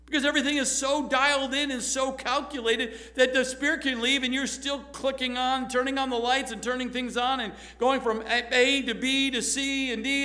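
ordinary delivery

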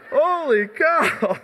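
slow, breathless after laughter; after laughing hard. Real, not acted. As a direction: catch your breath from laughing. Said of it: "let me compose myself".

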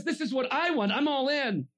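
high, full-force shout; impassioned preacher's cry; climactic declaration. Acted. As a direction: proclaim it loudly, building to the peak. Climax voiced as Jesus says.

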